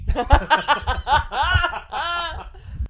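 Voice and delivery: loud, high burst of laughter; real belly laugh. Real, not acted. Storyteller laughing at the girl's verdict, cracking up. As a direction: crack up hard at your punchline.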